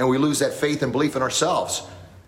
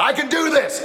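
much higher, loud rallying cry; loud coaching call; 4 dB louder than usual. Retold or imitated. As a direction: pump him up. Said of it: "I said".

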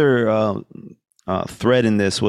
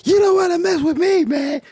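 much higher, strange manic voice; acting crazy. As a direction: play a twitchy madman trying to scare off a thug. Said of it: "get really nutty and be like".

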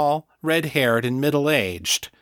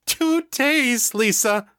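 grief-torn exclamation; 13 semitones higher, slower than usual. A narrator's reading. Mother crying out to Lisa about her missing son, grief-torn cry. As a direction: let the voice tear on the words. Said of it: "her voice tearing with grief".

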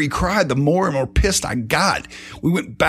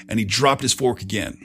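usual speaking voice

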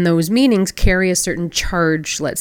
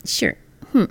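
obligatory grin. Retold or imitated. Flight attendant obliging an unwanted request, cold. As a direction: imitate forced politeness with pursed lips. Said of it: "that little obligatory grin".

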